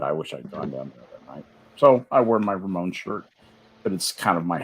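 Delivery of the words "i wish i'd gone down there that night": normal talking voice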